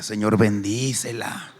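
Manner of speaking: mock-pious slow prayer; inner prayer. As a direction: pray it solemnly. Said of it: "por dentro orando por ella".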